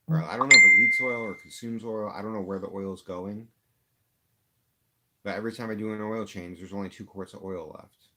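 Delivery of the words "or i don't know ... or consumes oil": a real angry burst, much louder; venting real fury at the car; mad outburst. Real, not acted. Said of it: "I am so mad".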